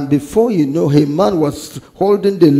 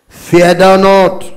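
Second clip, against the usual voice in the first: high, loud, drawn-out command; emphatic proclamation; 5 semitones higher, 7 dB louder, slower than usual. Real, not acted. Preacher reading God's command to the people, emphatic proclamation. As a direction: proclaim it slowly and loudly. Scripture command read out to congregation.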